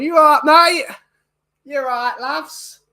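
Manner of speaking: high, hammed-up Northern English greeting; exaggerated high-pitched Northern English accent; mock Northern accent. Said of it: "one of them's from Manchester".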